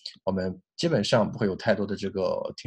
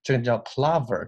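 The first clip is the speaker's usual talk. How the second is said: normal talking voice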